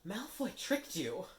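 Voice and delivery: high, slow, pointed Hermione voice; Hermione, urgent. Acted. Hermione scolds Harry, high and insistent. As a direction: spell out the trick to him.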